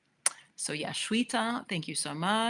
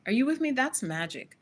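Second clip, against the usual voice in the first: usual speaking voice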